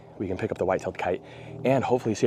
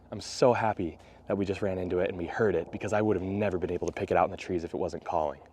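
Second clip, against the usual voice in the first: ordinary talk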